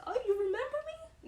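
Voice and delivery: squealed high and loud; thrilled surprise. Retold or imitated. New grad nurse thrilled a patient remembers her, squealing. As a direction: gush with delighted disbelief.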